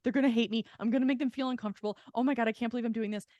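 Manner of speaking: spiralling in panic after the angry text; panicked spiral. Retold or imitated. Alone in the sauna after sending the text, fast panicked rambling.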